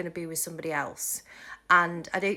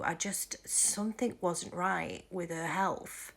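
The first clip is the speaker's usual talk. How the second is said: whispering; 6 dB quieter, slower than usual. Real, not acted. Hushed aside about health.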